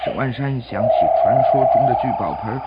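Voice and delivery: ordinary delivery, nothing marked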